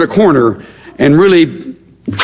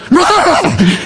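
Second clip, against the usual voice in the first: lioness snarl; 12 semitones higher than usual. Retold or imitated. Lioness warns the male lion coming by, mock roar. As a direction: snarl like a lioness warning him off. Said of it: "and she is like".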